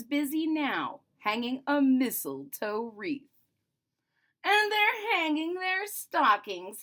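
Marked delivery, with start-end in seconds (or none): 4.4-6.8 sneering, high-pitched Grinch snarl. A narrator's reading. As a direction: spit it out with a sneer. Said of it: "he snarled with a sneer".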